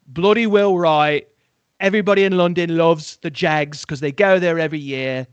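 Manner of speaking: loud, hammed-up London accent; put-on British accent. Host speaking for London Jags fans, mock British accent.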